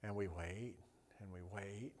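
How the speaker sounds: very soft, low storytelling; quiet, drawn-in narration of waiting; hushed suspense. A narrator's reading. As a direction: drop to a low hush for suspense.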